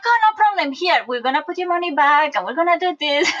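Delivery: high chirpy clerk voice with a laugh in it; cheery bank clerk. Retold or imitated. Clerk assuring the customer the money comes back, high and cheery. Helpful bank woman fixes the problem.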